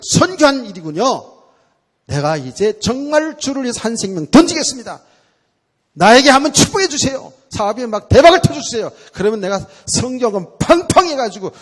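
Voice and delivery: loud, high, eager pleading; bargaining prayer. Retold or imitated. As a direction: promise God everything to get a blessing. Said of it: "impatient believer bargaining with God". (신도가 하나님께 복을 구하며 흥정하듯 기도)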